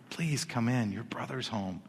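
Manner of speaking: soft, understated plea; gentle begging. Acted. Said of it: "came out and begged him".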